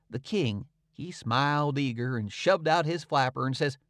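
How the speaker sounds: usual speaking voice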